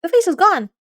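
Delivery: high, loud burst of excitement; sudden excited shout; excited exclamation. Real, not acted. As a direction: blurt it out, thrilled. Excited outburst at game event.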